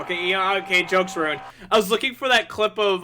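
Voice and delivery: frustrated shout. Real, not acted. Frustrated, joke ruined.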